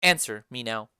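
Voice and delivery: low, commanding lady of the house; Lady Capulet. Acted. Lady Capulet calls the nurse for her daughter, low and commanding.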